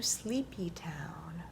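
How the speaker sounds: slow, soft whisper; whispered lullaby. A narrator's reading. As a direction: hush them off to sleep. Lulling line in bedtime story.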